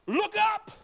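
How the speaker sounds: urgent call, then slow on lift up your heads; Jesus commanding. Acted. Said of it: "he says".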